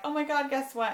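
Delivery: high, eager burst of news; bursting to tell him something big; retelling her own thrilled call to her husband; excited announcement. Retold or imitated.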